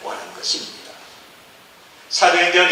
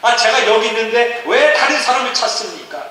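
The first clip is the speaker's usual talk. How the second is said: raised, insistent volunteering; eager pleading; 9 semitones higher, 5 dB louder than usual. Acted. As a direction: beg to be the one sent. Acted eager volunteer pleads with God. (간절히 자원하는 높은 목소리)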